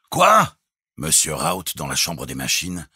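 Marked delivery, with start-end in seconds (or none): 0.1-0.6 very high, loud one-word question; startled shout. A narrator's reading. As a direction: shout it in disbelief. (cri interloqué)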